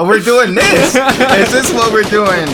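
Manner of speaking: high, loud, laughing protest; laughing disbelief. Real, not acted. Reacting amused to being called tryhard.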